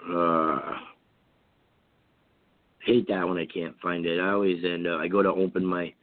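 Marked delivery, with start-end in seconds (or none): none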